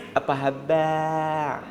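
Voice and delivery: slow, soft, sweet greeting; sugary politeness. Pretend. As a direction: greet her all honey and soft. Sweet to another man's wife. (sapaan manja yang dibuat-buat)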